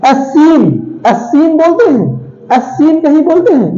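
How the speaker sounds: "as seen" with the S said as its own syllable; wrong reading. Shown as a demonstration. Teacher mocking a misreading of scene, wrong reading. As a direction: say "as seen", splitting off the first S. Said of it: "नहीं, इसे सीन बोलते हैं".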